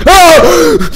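very high, loud shriek; real jump-scare scream; startled scream. Real, not acted. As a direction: scream in sudden fright.

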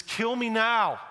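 bitter outburst. Acted. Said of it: "and says".

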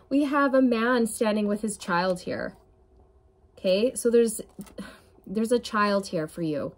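usual speaking voice

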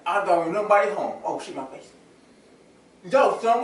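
ordinary talk

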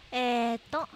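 ordinary talk

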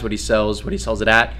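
usual speaking voice

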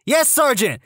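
high-pitched, dutiful recruit reply; recruit answering sergeant. Acted. Recruit to inspecting sergeant, high dutiful reply.

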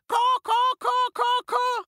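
very high, loud caw; bird cawing. A narrator's reading. The bird attacking the ogres, high cawing. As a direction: caw sharply like a pheasant. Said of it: "The bird pecked".

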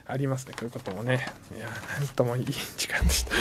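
low, soft and mellow; hushed wind-down. Real, not acted. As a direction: ease off quietly, almost to yourself. Winding down the calm corner.

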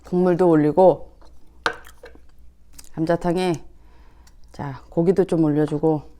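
soft unvoiced whisper. Real, not acted. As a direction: whisper it close to the mic. Mukbang whisper.

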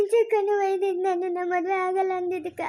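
sobbing through the words; tearful explanation. Acted. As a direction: explain it while crying. Sister tearfully explains forced marriage.

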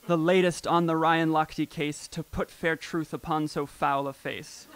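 mock-grand Shakespearean reading; poem recitation. Shown as a demonstration. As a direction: declaim it like a stage sonnet. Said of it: "beautiful prophetic couplets".

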